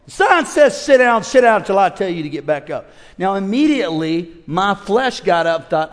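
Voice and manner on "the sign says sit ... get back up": sharp, raised order from the steward; loud command. Retold or imitated. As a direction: bark it like an irritated steward. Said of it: "he tells me real loud".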